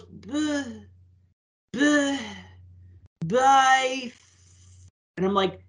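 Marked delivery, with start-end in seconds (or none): 0.0-4.1 mock stammer on "Buh, buh, buy"; stuck on the first sound, "Buh, buh, buy"; stumbling syllables. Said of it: "And then she goes".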